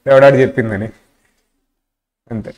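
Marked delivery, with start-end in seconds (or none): 0.1-0.9 louder retort from a boss; mock angry boss. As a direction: snap back as the annoyed boss. Boss snapping back at student. (కోపంగా boss అనుకరణ)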